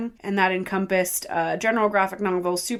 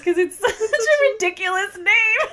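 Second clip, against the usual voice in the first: breaking into giggles mid-sentence, high-pitched; can barely get the name out for laughing; 13 semitones higher, 5 dB louder than usual. Real, not acted. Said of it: "I can't say it without laughing".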